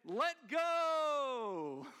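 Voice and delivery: high, loud, long-drawn call; acted distant shout, vowels stretched; voice from above. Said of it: "And the voice said".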